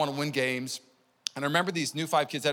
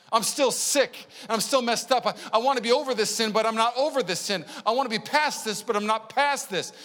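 pleading cry; 8 semitones higher, 5 dB louder than usual. Retold or imitated. Church crying out to healer.

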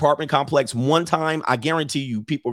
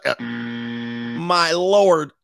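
slow stunned my lord; 3 semitones lower, much slower than usual. Real, not acted. Reacting to shocking picture.

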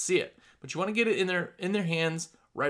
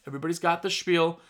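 ordinary talk

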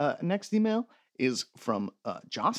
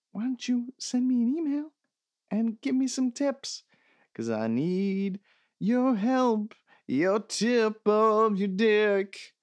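mock plea; 7 semitones higher, slower than usual. Mock plea builds for emphasis.

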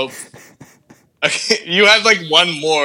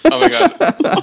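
laughing; 12 semitones higher than usual. Real, not acted. Laughing disbelief.

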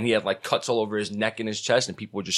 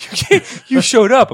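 much higher and louder disbelief; amazed squeal; 8 dB louder, slower than usual. Pretend. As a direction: gasp it out in shock. Amazed fan in imagined scene.

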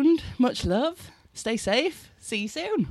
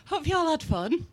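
excited; 10 semitones higher, 5 dB louder than usual. Real, not acted. Excited thanks after the show.

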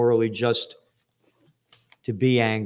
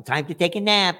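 lilting sing-song, mocking; teacher calling nap time; 4 semitones higher than usual. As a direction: imitate the kindergarten teacher again. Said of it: "That's what they told me in kindergarten".

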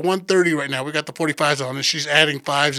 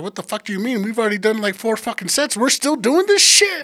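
acted outrage, climbing in pitch to the end; exasperated protest; 6 semitones higher than usual. Lifter protests yet another set to girlfriend, exasperated.

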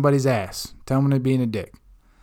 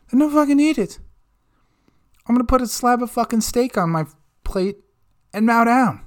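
defiant outburst; 9 semitones higher than usual. Real, not acted. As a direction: shout it out in triumph.

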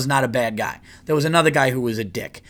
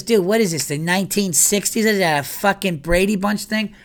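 higher, teasing scorn; mocking Bobby; 6 semitones higher than usual. Friend teasing him about the old bag, higher voice. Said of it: "He goes".

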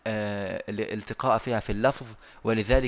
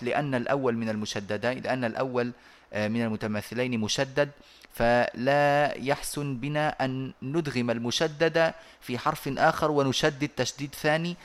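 normal talking voice

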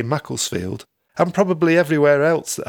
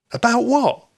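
higher, worried question; alarmed; 11 semitones higher, 3 dB louder than usual. Acted. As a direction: ask it suddenly worried.

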